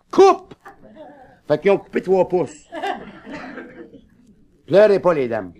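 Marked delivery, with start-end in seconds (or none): 0.1-0.5 high loud cry of coupe; sudden shout. Acted. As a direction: blurt it out, decided. Stutterer bursts out decision.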